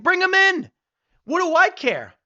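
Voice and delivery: very high, loud outburst; defiant shout. Acted. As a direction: throw it out as a loud dare. Exclaims his defiant stance.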